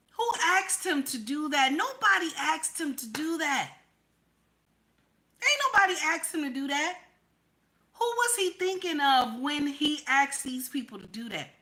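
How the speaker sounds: high-pitched, insistent disbelief; real incredulous questioning. Real, not acted. Escalating rhetorical question in political rant.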